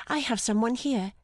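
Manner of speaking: high-pitched delighted woman's voice. A narrator's reading. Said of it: "said her delighted voice".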